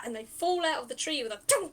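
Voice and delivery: ordinary delivery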